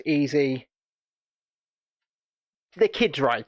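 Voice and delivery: usual speaking voice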